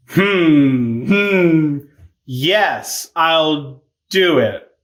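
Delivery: drawn-out hesitant agreement; mock reluctance. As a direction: hum doubtfully, then agree very slowly.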